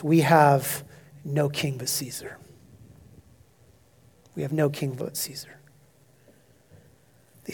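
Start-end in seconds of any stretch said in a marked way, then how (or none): 0.0-5.6 low and much softer; fateful words. Acted. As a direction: let the fateful words sink quietly. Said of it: "these are fateful words".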